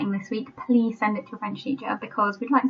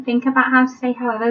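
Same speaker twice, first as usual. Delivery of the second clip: normal talking voice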